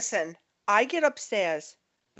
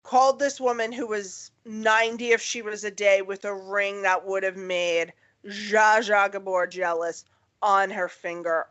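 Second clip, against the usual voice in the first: ordinary talk, nothing marked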